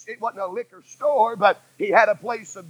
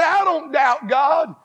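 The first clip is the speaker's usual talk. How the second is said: mock shout; 6 semitones higher, slower than usual. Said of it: "you say".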